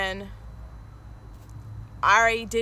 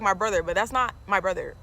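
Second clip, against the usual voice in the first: ordinary talk, nothing marked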